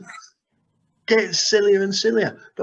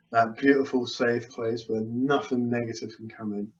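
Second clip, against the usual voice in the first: hypnotic trance induction; 7 semitones lower than usual. Acted. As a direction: guide them gently back into a deep trance.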